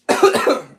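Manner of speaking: sudden choking cough; coughing fit. Real, not acted. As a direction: cough on food going down wrong. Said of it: "I'm choking on my eggs here".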